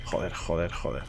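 soft, low repeated curse; cursing under breath. Real, not acted. Tras fallar, maldice bajo. (maldiciendo en voz baja)

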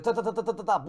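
rapid ta-ta-ta gun noises; mock gunfire. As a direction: rattle off imitated machine-gun shots. Firing again.

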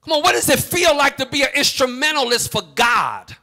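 mounting sermon intensity; preach. Real, not acted. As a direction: pour out the complaint to God in full preaching voice. Preaching at full force.

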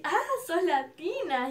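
high, slow swooping reaction; pleased surprise. Retold or imitated. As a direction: imitate a delighted realisation. Idol reacts to her origin.

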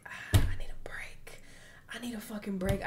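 breathy, sighing retold plea; breathy frustration at being overwhelmed; exhausted plea. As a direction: sigh it out, worn out and fed up. Retelling own burnout plea.

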